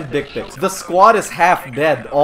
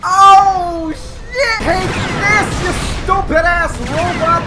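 much higher, louder shouted taunt; hammed-up furious gamer yell; angry battle shout; 13 semitones higher, 7 dB louder, much slower than usual. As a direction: blast the robot while yelling. Firing at robot.

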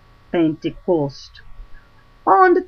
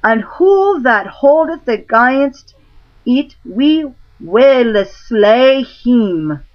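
crowd crying out; 5 semitones higher, 4 dB louder than usual. Acted. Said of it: "all the commons cried at once".

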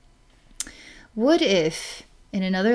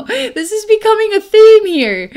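high, loud burst through laughter; real laughing; 12 semitones higher, 8 dB louder than usual. Real, not acted. Psychic amused by recurring witch theme, laughing. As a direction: squeal it out, cracking up.

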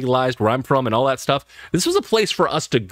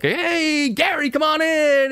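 much higher, slow welcoming call; hearty host greeting; much slower than usual. Retold or imitated. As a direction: call out to a friend at the door. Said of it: "Would you like".

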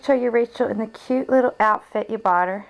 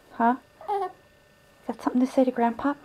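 normal talking voice

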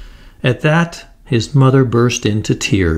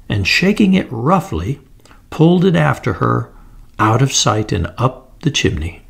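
plain reading voice

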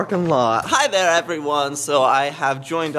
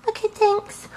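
very high cartoon voice; tiny high-pitched thank you; squeaky pet voice. Pet voice answers.

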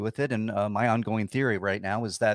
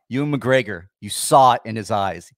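ordinary talk

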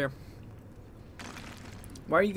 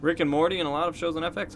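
ordinary talk, nothing marked

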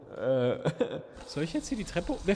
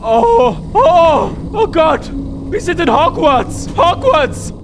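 excited shouting; 9 semitones higher, 10 dB louder, slower than usual. Real, not acted. Sudden surprise in game.

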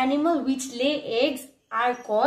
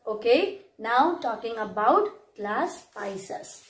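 usual speaking voice

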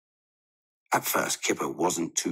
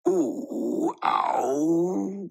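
put-on high child's wail of pain; 9 semitones higher than usual. A narrator's reading. Kipper wails as Dad starts the haircut. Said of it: "wailed Kipper".

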